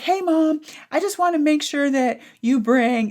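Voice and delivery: put-on high, breezy kid voice; casual child voice. The daughter asking for a dance snack, high breezy kid voice. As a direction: ask like nothing's wrong.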